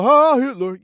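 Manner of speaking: loud, high mock outburst; imitated club crowd shout; mock cheering. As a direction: blurt it out like an excited clubgoer. Imitates crowd hearing the song.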